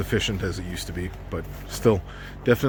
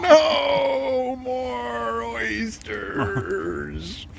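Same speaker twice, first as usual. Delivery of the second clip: mock wail; 13 semitones higher, much slower than usual. Mock protest about eating oysters.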